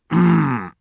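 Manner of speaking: невнятное мычание. Acted. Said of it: "промычала она в ответ".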